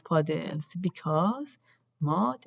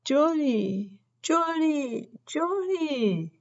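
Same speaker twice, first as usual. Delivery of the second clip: rising calls, higher each time; calling out; 8 semitones higher, slower than usual. A narrator's reading. Children call into the yard for Jory, rising anxious calls. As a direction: call out for the lost baby.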